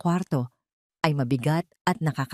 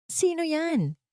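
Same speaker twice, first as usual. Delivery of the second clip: panicked cry; 11 semitones higher, slower than usual. A narrator's reading. Anton calls to whoever rang at midnight, rattled. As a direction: call out in a high-pitched panic. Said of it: "Sigaw niya halatang nataranta".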